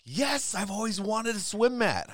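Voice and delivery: high, loud fake gushing; husband mocking fake joy about a swim mat; mock excitement. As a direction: gush sarcastically over a swim mat. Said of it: "I'm not like".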